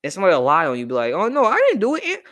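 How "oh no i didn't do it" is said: put-on high, loud-ish kid protesting innocence; little kid. Little kid denying a classmate's lie, high-pitched protest. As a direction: plead like a wrongly blamed kid, pitched way up. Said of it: "when you were a little kid".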